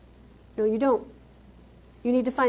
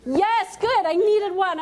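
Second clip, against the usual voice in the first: higher and louder, a bright teasing cheer; playful excitement; 10 semitones higher, 4 dB louder, slower than usual. Acted. Speaker thanking the crowd for its amen, playfully excited. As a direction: reward the crowd like you won a prize.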